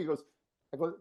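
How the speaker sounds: ordinary delivery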